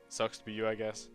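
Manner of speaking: ordinary delivery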